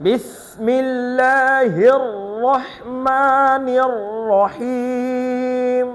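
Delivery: slow, careful recitation; model recitation. Shown as a demonstration. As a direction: recite each word clearly for the student. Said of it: "এই জন্য মনোযোগ দিয়ে ধরো।". (মনোযোগ দিয়ে ধীর তিলাওয়াত)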